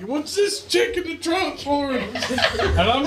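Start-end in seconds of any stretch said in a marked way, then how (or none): 0.0-1.9 high, slow panicked yell; freaked-out shout. Retold or imitated. Scared hitchhiker shouting high and slow about the girl in the trunk. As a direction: cry out in alarm, stretching it.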